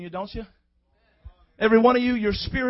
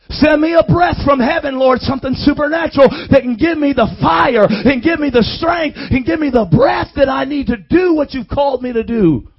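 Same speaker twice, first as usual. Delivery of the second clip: loud, driving sermon delivery; fired-up preaching; 4 semitones higher, 11 dB louder than usual. Real, not acted. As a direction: preach it hard to rouse the congregation. Preaching at full force.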